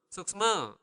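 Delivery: "Suksma" in a Balinese accent, ending on an open "a"; speaker's Balinese accent. Shown as a demonstration. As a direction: say "Suksma" with a local Balinese lilt. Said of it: "My accent".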